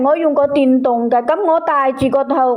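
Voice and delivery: ordinary talk, nothing marked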